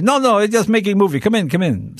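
put-on Russian accent rushed to wave the guest in; Boris's Russian accent. Russian neighbor waves Jay in, Russian accent quickening. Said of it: "And he goes".

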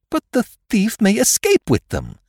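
urgent protest. Acted. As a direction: object in alarm, voice way up.

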